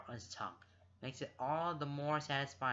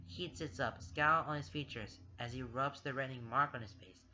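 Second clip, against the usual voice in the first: usual speaking voice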